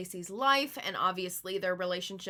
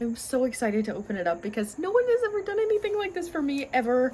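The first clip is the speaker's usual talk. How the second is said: excited; 5 semitones higher, 5 dB louder, faster than usual. Real, not acted. Gushing over a handmade gift.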